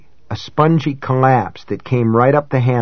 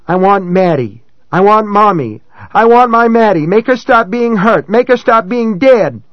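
put-on child crying; 9 semitones higher, 4 dB louder than usual. A narrator's reading. Child weeping hysterically.

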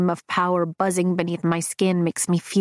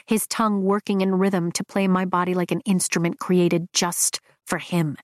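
usual speaking voice

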